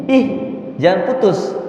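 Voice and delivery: usual speaking voice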